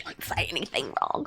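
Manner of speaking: whisper. Retold or imitated. Retold inner paranoid thought.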